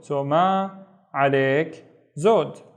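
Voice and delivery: ma alayk zod, slow and separated; slow phrase read. Shown as a demonstration. Teacher reading the reply phrase slowly.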